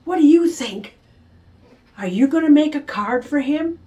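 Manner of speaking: a touch of excited chatter, high; excited girl. Acted. Girl calling her friend Becky, mildly excited. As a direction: gush into the phone.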